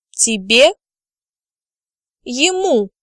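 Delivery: usual speaking voice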